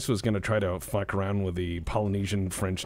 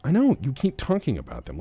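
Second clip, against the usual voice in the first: ordinary talk, nothing marked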